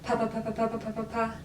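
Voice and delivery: rapid pa pa pa repeats; plosive drill. Shown as a demonstration. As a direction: fire off pa as fast as possible. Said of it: "as fast as we can".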